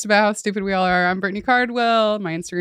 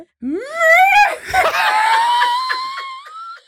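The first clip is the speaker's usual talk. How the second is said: witch cackle. Shown as a demonstration. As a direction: shriek it like a storybook witch. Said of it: "You sound like a witch".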